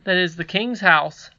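put-on polite boy correcting his elder; respectful. A narrator's reading. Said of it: "returned Curdie respectfully".

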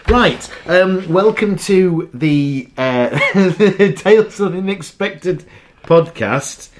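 loud, slow show-opening announcement; podcast host intro. Acted. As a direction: welcome listeners like a host.

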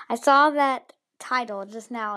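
usual speaking voice

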